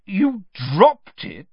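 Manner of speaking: slow, loud, incredulous echo; disbelief. A narrator's reading. Official repeating boy's claim, disbelieving. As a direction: stretch out DROPPED in disbelief. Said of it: "repeated Mister Diggory in disbelief".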